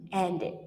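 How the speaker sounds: "ended" as two syllables, ending id; teacher's model of "ended" with id; extra id syllable. Shown as a demonstration. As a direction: say "ended" like end-id. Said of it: "we pronounce it ed".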